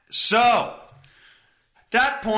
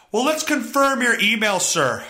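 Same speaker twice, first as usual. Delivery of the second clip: mock agent voice; 7 semitones higher than usual. Imitating the customer service agent.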